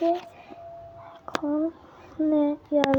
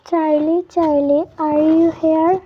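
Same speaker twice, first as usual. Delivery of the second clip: loud summoning call into the dark; 2 semitones higher, 9 dB louder, much slower than usual. Pretend. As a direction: shout the name to summon the spirit. Calling the spirit Charlie loudly. (জোরে ডাকা)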